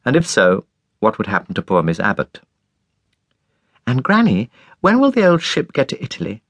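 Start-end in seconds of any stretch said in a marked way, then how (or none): 3.9-6.4 put-on high eager child's voice; little girl. A narrator's reading. Irma asking her grandmother, childlike and eager. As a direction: ask it like a curious little girl. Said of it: "asked Irma".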